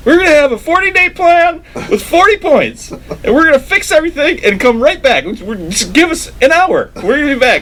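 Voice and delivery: very high, excited promise; Michael Scott. Retold or imitated. Imitating a boss addressing the board, high bluster. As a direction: announce a hopeless plan in a high voice. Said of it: "And then he's like".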